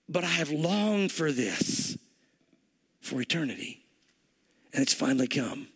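deep-felt, unhurried longing; tender longing. Acted. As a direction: speak as one who has waited forever, sinking low. Voices Jesus's long-held yearning.

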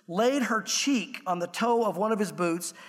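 usual speaking voice

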